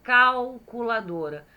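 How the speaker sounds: "calculadora" opening with a clear "cau"; L sounded as U. Shown as a demonstration. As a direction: show the L of "calculadora" turning into U. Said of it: "O cal acaba saindo U".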